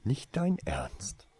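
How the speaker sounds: slow, high, horrified disbelief; lightly voiced shocked hiss. A narrator's reading. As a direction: gasp it out, appalled. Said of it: "zischte sie entsetzt zurück".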